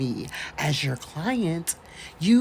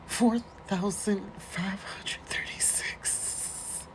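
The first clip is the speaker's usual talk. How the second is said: whispered; 4 semitones higher, 5 dB quieter, slower than usual. Real, not acted. Reading a figure in disbelief.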